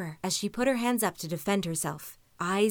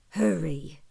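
growl; 3 semitones lower than usual. A narrator's reading. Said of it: "it growled".

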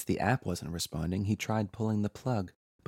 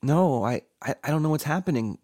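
higher, louder flustered denial; insisting; 6 semitones higher, 7 dB louder than usual. A narrator's reading. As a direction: stammer a quick, urgent denial. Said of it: "Patrick insisted".

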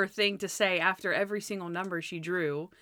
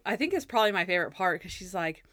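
ordinary delivery

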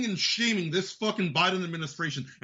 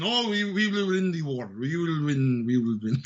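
sinking much lower with each "We will win"; mock begging Zelensky; 7 semitones lower than usual. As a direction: drop the voice deeper each time, pleading. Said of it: "begs for money again".

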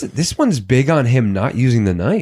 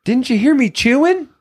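high mocking friend voice, much higher pitch; mocking Annie; 12 semitones higher than usual. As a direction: mimic a smug rude caller in a squeaky pitch. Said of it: "and the phone rings again".

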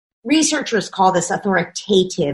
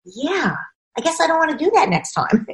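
put-on child agreeing; 7 semitones higher, 3 dB quieter than usual. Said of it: "And the kid's like".

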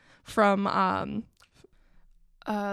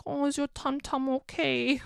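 boyfriend's mock baby talk, raised pitch; 5 semitones higher than usual. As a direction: coo like to a toddler, lightly. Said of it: "talking in the baby voice".

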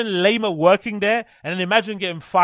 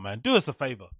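normal talking voice

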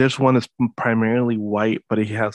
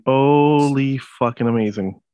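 slow, drawn-out astonishment; amazed; 2 semitones higher, much slower than usual. Real, not acted. Co-host reacting to Hitler-lookalike cats, amazed. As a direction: stretch it out in awe.

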